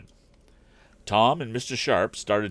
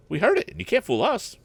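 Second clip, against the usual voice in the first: a much higher, insistent exclamation; exclaiming; 5 dB louder than usual. A narrator's reading. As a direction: call the bluff, pitched high. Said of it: "exclaimed Pete".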